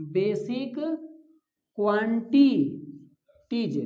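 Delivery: बेसिक then क्वांटिटीज drawn out very slowly in two parts; slow pronunciation demo. Shown as a demonstration. Teacher sounding out basic quantities slowly for students. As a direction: spell out क्वांटिटीज slowly for the class. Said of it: "pronunciation कर दें".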